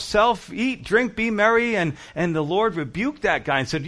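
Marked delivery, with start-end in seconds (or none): 0.0-1.8 higher, lifted voice of the rich fool; playful higher mock-toast; smug self-toast. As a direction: savour it like a man toasting himself.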